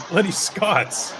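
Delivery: mock Scottish. Joking Scottish voice after Scots talk.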